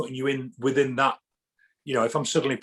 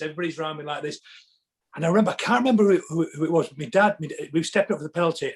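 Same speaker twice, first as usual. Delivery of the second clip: ordinary talk, nothing marked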